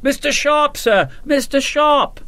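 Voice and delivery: high-pitched hail from across a field; small eager voice. A narrator's reading. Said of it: "small, high, and eager voice hailed him".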